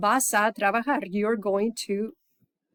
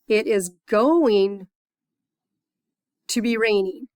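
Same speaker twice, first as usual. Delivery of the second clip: very slow, louder on going; slow model sentence; 5 dB louder, slower than usual. Shown as a demonstration. Teacher modelling the future for students, slow and louder. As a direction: stretch it out so students catch it. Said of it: "So what we want to say is".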